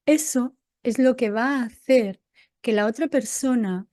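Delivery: ordinary talk, nothing marked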